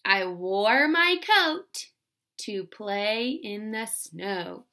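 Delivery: slow, careful dictation. Shown as a demonstration. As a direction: say it slowly so they can write.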